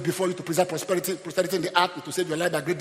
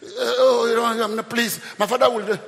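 mock wailing plea, pitched up; acted crying; 6 semitones higher, 5 dB louder, slower than usual. Brother begging after the cup is found, acted tearful plea. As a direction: plead in a put-on cry. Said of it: "The brother started crying".

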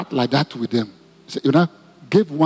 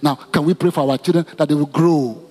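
leading prayer; 3 semitones higher than usual. Acted. Pastor leads congregation praying for mothers, fervent prayer. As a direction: lead the church in prayer for mothers and children.

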